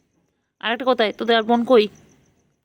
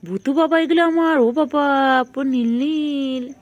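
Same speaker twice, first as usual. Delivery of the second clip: high sing-song pet talk, louder; 6 semitones higher, 6 dB louder, slower than usual. Real, not acted. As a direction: coo at the birds like they're babies. Cooing at pet birds in light. (পোষা পাখিদের উঁচু গলায় আদুরে আদর)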